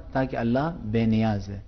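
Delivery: usual speaking voice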